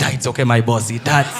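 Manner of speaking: low, offhand brush-off; dismissive rider. Retold or imitated.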